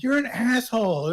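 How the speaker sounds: loud, high imitated insult; retold indignant outburst. Woman snapping at the departing customer, retold indignant outburst. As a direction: drop the affectation and blurt it out loudly. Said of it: "That's your actual voice".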